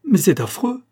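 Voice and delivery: high-pitched cry of dismay; stamping outburst. A narrator's reading. Wife protesting the divorce, stamping outburst. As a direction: protest like a stamping little doll. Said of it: "trépigna-t-elle".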